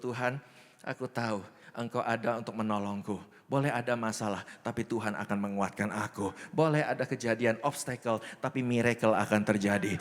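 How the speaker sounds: low, steady prayer of trust; doa syukur. Shown as a demonstration. Models a thankful morning prayer. (doa penuh keyakinan, nada rendah)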